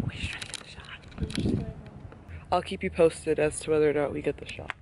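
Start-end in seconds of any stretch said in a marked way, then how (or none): 0.1-1.7 high breathy whisper. Real, not acted. Still waiting for the photo, a high whispered plea.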